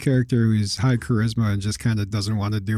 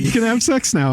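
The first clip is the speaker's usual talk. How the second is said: high, imitated announcement; retold game master; 9 semitones higher than usual. Player retelling the dice result, high imitating voice. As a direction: pitch it up in mock permission. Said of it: "he was like".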